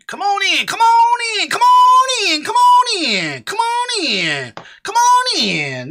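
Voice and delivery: high, loud call-in shout. Real, not acted. Streamer calling viewers into the stream, high and loud shouting. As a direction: call them in from afar.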